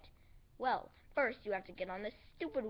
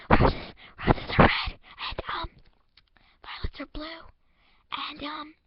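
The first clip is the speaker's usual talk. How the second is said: jumpy whisper-and-shout creepy recital; mock-spooky poem read to scare the viewer; creepy poetry; 5 semitones higher, 12 dB louder than usual. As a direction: swing from harsh whispers to sudden loud threats. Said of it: "Now here's some creepy poetry".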